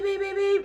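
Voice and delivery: rapid very high beeps; imitated horn beeps. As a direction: honk in a high, insistent voice. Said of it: "they beep".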